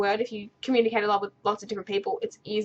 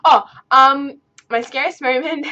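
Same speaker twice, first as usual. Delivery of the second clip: excited reaction; 4 semitones higher, 9 dB louder, slower than usual. Real, not acted. Reacting to the scary question.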